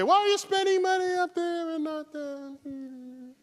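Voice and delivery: high mocking voice of a skeptic; mocking critic. Mimicking people who complain about space spending, high and mocking. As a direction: squeak it like a whiny critic. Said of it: "So the people say".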